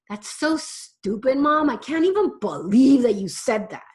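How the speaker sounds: lightly voiced scornful teenager; mock teen retort. Teen scoffing at mom, mild mock contempt. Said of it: "looks at you and goes".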